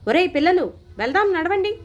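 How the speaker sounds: put-on father impatiently ordering children to go; impatient shout. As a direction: bark at the kids to leave now. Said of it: "అసహనంగా లేచి అరిచాను".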